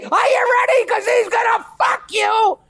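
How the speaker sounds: high, shrieking parrot squawk; piercing cartoon parrot yell. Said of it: "and the parrot says".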